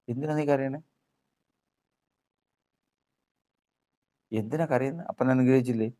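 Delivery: probing questions. Acted. Angel questions the weeping Jacob. (ചോദ്യം ചെയ്യുന്ന സ്വരം)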